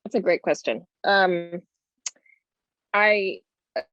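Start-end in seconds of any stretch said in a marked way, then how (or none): none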